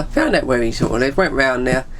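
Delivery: usual speaking voice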